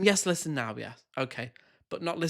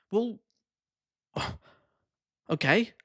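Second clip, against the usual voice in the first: ordinary talk, nothing marked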